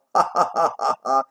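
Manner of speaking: a mock jolly laugh like the laughing nephew; imitated hearty laugh. Teller imitates the nephew's constant laughing, mock hearty laugh. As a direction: laugh it out like the merry boy. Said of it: "he's quite the laughing boy".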